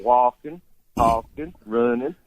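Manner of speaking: "walking, talking, running" stretched out in Southern style; Cockney slowed into a Southern drawl. Shown as a demonstration. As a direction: draw out each word like a Georgian. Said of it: "if you slow it down, it comes out".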